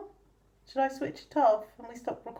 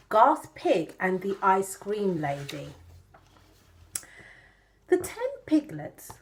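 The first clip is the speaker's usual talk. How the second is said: ordinary delivery, nothing marked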